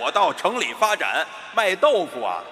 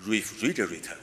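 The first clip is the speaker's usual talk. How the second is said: low, soft British refrigerator from the back; British accent; 9 semitones lower, 7 dB quieter than usual. Shown as a demonstration. Teacher showing British pronunciation, low and throaty British accent. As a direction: set refrigerator far back, mock British. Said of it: "英音说这个词都这样说".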